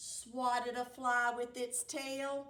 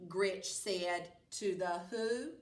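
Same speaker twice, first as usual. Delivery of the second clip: ordinary delivery, nothing marked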